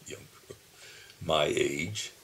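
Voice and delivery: ordinary talk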